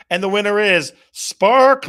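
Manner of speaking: loud, bright game-show reveal; winner announcement. Real, not acted. Host revealing the winner to viewers, excited.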